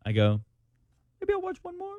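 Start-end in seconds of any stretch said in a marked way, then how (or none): 1.2-1.9 very soft, high little voice; sheepish murmur. Retold or imitated. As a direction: sneak it out softly, tempted by another episode. Parent quoting self sneaking another episode.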